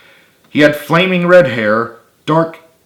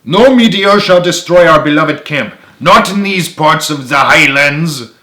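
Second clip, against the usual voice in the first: high, loud Scottish battle cry; hammed-up Scottish heroics, slowing at the end; dramatic Scottish proclamation; 5 semitones higher, 6 dB louder than usual. A narrator's reading. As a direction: proclaim it grandly in a rough Scottish accent. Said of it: "Reginald proclaimed dramatically".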